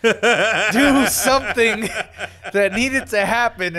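loud laughing confession. Real, not acted. Laughs while admitting a wrong prediction.

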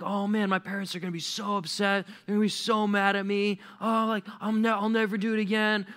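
high-pitched wailing kid, played for effect; mock crying. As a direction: blubber like a teenager caught shoplifting. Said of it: "and crying".

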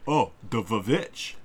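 slow, drawn-out mimicry; mocking quote. Co-host mimicking a friend's reaction to the film title, mocking. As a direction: mimic them with exaggerated slowness. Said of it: "and you're like".

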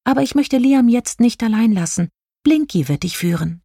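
high-pitched fairy voice, settling at the end; high, light character voice; star fairy explaining. A narrator's reading. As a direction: explain kindly as a little fairy. Narrator voices Jelina.

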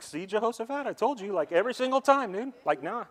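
high, indignant whine of a sulking king; a petulant king venting, pitched high; mock angry king. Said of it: "so Ahab gets mad".

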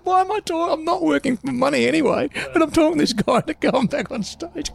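very high-pitched comic exasperation; squeaky, incredulous retelling; amused disbelief. Retold or imitated. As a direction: tell it as an absurd punchline. Retold disbelief, amused at himself.

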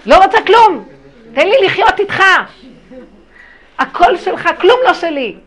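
a mother pleading loudly that she renounces it all. Retold or imitated. Pleading quote to her son.

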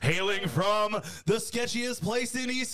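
high, stretched-out hype announcer shout; ring announcer. Acted. Host introducing a guest from the Gold Coast, announcer shout. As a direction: draw out the name like a fight-night announcer. Hypes second guest likewise.

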